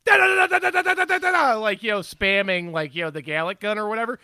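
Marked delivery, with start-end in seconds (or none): 0.0-1.8 loud high "da da" bursts; energy blast noises. Shown as a demonstration. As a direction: spam blast sounds like an anime hero. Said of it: "when Goku's all".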